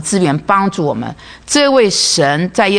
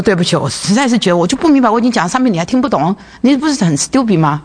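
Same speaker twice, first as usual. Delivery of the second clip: exasperated; 6 semitones higher, faster than usual. Retold or imitated. Losing patience with coworker.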